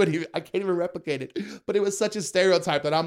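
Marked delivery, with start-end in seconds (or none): none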